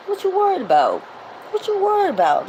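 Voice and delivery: high teasing sing-song question; playful dismissive. Real, not acted. To her Spirited Warriors, high playful brushing off of worries. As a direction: brush their worries off lightly. Rallying followers, rhetorical repeat.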